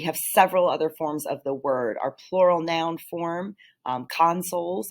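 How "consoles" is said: consoles with the first syllable stressed, CON-soles; stress on first half of consoles. Shown as a demonstration. Teacher gives the plural noun form, consoles stressed on the first half. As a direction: punch the front of consoles, as the noun. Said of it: "emphasis on the first half".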